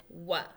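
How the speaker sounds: WH said "wa" as in wh-; digraph sound. Shown as a demonstration. Teacher to young readers, isolated "wa" sound. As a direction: sound out "wa" for the class. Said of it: "we learned says".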